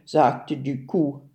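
plain reading voice

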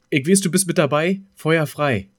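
normal talking voice

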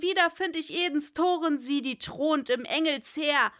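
high, loud, fast frantic pleading; frantic shouting. A narrator's reading. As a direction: beg the bird wildly for news of Lenore. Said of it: "rief ich voll Zweifel". (hohes, lautes, hastiges Flehen)

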